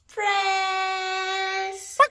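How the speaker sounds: high, long sung-out call; drawn-out cheery cry; playful shout. Shown as a demonstration. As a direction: call it out, stretching the word.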